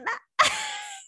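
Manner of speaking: squeaky high giggle; a sudden high-pitched laugh. Real, not acted. Laugh after swoon.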